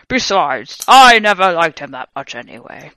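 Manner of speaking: frowning. A narrator's reading. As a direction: say it with a frown, dismissively. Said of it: "he said while he frowned".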